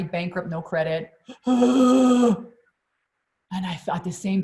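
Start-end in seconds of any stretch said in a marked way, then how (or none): 1.3-2.4 sharp loud gasp of alarm; panicked gasp. Retold or imitated. Driver in panic over losing her job, loud gasp. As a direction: gasp as if the fear just hit.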